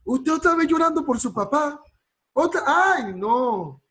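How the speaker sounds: high, loud mock scolding of someone crying; a fed-up relative loudly scolding a mourner for crying; exasperated scolding relative. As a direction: nag them for crying again, voice shooting up high. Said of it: "Hay gente".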